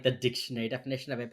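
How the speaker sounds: normal talking voice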